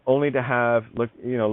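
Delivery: ordinary talk, nothing marked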